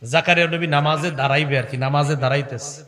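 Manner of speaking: ordinary talk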